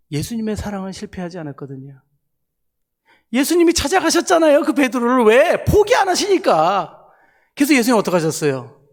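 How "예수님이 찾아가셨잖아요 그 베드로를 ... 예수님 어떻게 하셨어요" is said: higher, louder fervent proclamation; preacher proclaiming that Jesus never gives up; fervent preaching. Real, not acted. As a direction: raise it up, climax of the sermon. Preacher's climax on Jesus' love.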